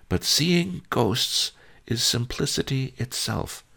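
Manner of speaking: put-on old master, reassuring. A narrator's reading. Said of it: "he said reassuringly".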